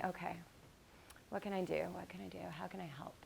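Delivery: soft, hushed worried musing that sinks to a whisper; anxious inner thought. Retold or imitated. Wife wondering how to help husband, hushed worried inner voice. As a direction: think it quietly to yourself, fretting.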